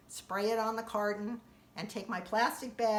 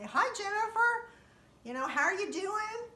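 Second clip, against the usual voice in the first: cheery greeting; 12 semitones higher, 4 dB louder than usual. Retold or imitated. Friendly wave to the mail lady.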